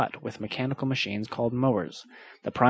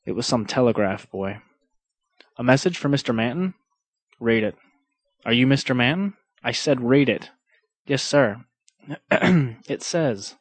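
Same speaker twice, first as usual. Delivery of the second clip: hard-boiled detective narration; noir detective; 4 dB louder than usual. A narrator's reading. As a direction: read it like a pulp crime story. Reads noir story aloud.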